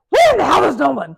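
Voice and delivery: loud, fast fed-up demand; exasperated yell. Retold or imitated. Stuck mom phoning again, loud fast exasperated yell. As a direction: snap it down the phone in frustration. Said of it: "She goes".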